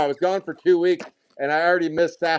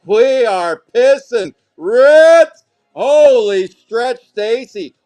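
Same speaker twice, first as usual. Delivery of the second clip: loud, high hype with stretched words; excited shouting; 5 semitones higher, 9 dB louder, slower than usual. Real, not acted. Card breaker celebrating a second red, excited shouting. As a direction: build to a drawn-out celebratory shout. Host erupts over pulling reds.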